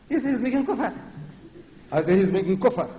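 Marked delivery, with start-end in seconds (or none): 0.1-0.9 high, lightweight parody; mocking meek voice. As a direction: mimic a timid preacher, pitched up. Mimics a meek way of saying it.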